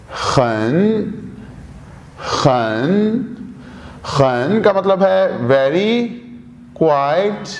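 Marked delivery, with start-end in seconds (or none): 0.1-3.3 खन said low, Mandarin syllable; Chinese word demo. Shown as a demonstration. Teacher drilling खन for students, low and careful. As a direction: model खन slowly and low. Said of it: "अगला लफ्ज है खन".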